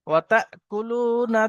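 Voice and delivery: slow drawn-out melodic chant; Quran recitation. Acted. Teacher reciting the verse for students, slow melodic chant. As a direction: intone it slowly, stretching the vowels.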